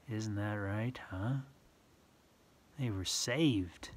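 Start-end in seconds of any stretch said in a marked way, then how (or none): none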